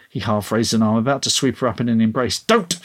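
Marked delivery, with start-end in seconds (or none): 2.4-2.8 high, sudden sharp refusal; snapped. A narrator's reading. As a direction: snap it as you back away. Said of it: "she snapped, backing away".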